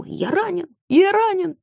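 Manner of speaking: high yelp of pain; man down shout. A narrator's reading. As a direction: cry out wounded, high. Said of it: "заорал".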